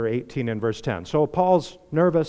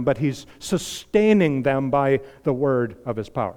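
ordinary delivery

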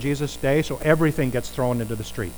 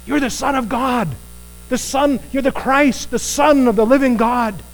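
Peter's proclamation; 9 semitones higher than usual. Acted. Peter's confession voiced.

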